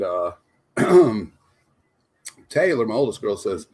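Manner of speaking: normal talking voice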